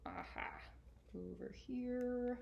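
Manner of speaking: ordinary delivery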